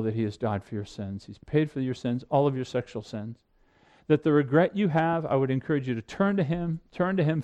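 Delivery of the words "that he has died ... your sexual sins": tender reassurance. Acted. Gospel reassurance to Christians.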